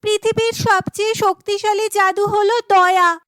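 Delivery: put-on high, emphatic girlish answer; confident heroine. Chandrika answers the cave's riddle, high and sure.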